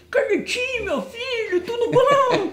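high, sing-song fatherly greeting; mock sweet greeting. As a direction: greet him like a doting father, high and sugary. Narrator greets Carlitinho, mock-sweet. (cumprimento doce e fingido, bem agudo)

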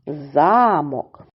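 Замок with a long stressed ЗА; stress on first syllable. Shown as a demonstration. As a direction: stretch the За in Замок. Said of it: "припадає на перший склад".

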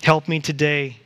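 slow, reverent prayer voice. Acted. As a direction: pray it earnestly and slowly. Leads prayer.

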